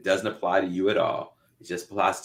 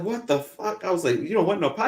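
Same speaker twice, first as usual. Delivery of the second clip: high-pitched mock outrage; incredulous; 11 semitones higher than usual. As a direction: act out disbelief, pitched way up. Acted quarrel with his own body.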